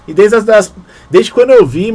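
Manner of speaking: sudden high, loud burst of excitement; excited. Real, not acted. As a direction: blurt it out, thrilled. Empolgado falando da música. (empolgado, voz alta e aguda)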